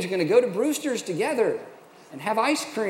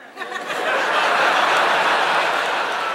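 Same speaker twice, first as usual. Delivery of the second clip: high breathy burst of laughter; rueful laugh; 10 semitones higher, 4 dB louder than usual. Real, not acted. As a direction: laugh at yourself, loud. Laughing at own story.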